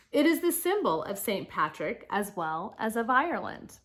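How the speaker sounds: projected, lilting read-aloud with punched openings; reading to children. Acted. Teacher reads Saint Patrick's life story to children, animated read-aloud. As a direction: read clearly to the class, lifting key words.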